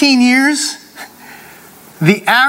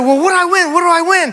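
high-pitched eager questioning; imitated contestant's eagerness; eager winner; 8 semitones higher than usual. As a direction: act out an excited winner asking for the prize. Acted eager contest winner asking.

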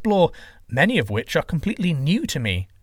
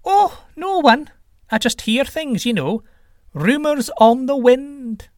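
very high, slow, airy teasing; sly evasive trickster; 13 semitones higher, 4 dB louder, slower than usual. Acted. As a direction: float the words away mysteriously. Trickster slyly dodging the question.

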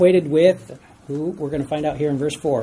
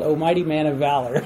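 laughing; 3 semitones lower than usual. Real, not acted. Laughing at Gideon's grand title.

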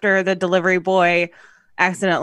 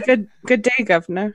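mock British accent. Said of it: "I said".

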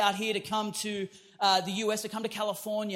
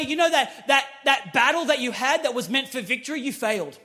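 louder, higher sermon drive; full-force preaching; 5 semitones higher, 6 dB louder than usual. Acted. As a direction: preach it hard to the congregation.

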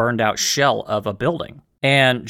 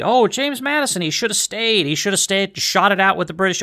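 lightly voiced scoffing critic, higher; mock critic; 6 semitones higher than usual. Host mocking people who say Madison should have stayed, in a higher scoffing voice. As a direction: mimic an armchair critic who scolds Madison. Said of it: "Some people would say he should have stayed".

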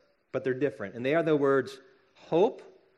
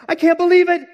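high squealing delight, much louder; so excited; 13 semitones higher, 13 dB louder than usual. Acted. Little girl finds manure in her stocking, squealing with excitement. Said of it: "she gets so excited".

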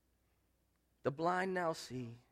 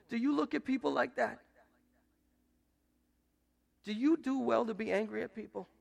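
ordinary talk

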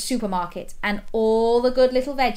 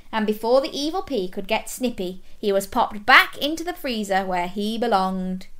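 usual speaking voice

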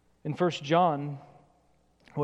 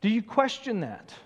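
ordinary talk, nothing marked

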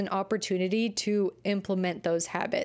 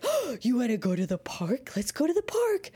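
gasp, then a high excited invitation; 4 semitones higher than usual. Pretend. Owner thrilled to take her dog to the park, high and excited. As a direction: pitch it up like asking a kid to the park.

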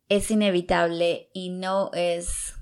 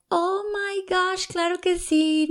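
much higher and slow, gushing mock American girl; high, slow imitation of chirpy American girls agreeing eagerly; imitating American neighbor girls; 12 semitones higher, slower than usual. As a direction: play the friendly American neighbors, pitched way up and drawn out. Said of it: "Y ellas se quedaron así como".

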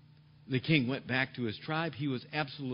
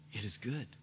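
it is good said softly and low, gently settled; peaceful; 7 semitones lower, 8 dB quieter than usual. Acted. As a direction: let it is good fall quiet like a sigh of trust. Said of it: "A peaceful attitude says".

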